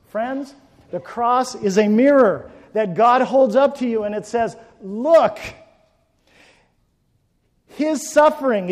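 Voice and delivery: loud, slow, high-pitched pulpit cry; preaching at full force. Acted. Preacher to his congregation, loud slow pulpit cry. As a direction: drive each line home to the congregation.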